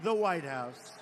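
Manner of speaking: rising rally cadence; rally speech. Real, not acted. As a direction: address the crowd with rising force. Campaign rally address.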